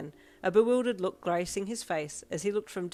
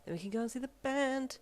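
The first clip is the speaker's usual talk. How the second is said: proud; 8 semitones higher than usual. A narrator's reading. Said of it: "you thought proudly".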